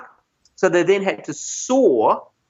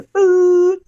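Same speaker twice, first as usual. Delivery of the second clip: loud, high, held siren hoot; imitated siren; 13 semitones higher, 6 dB louder than usual. Storyteller mimicking fire engines arriving, loud siren noise. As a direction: mimic a siren on one long note.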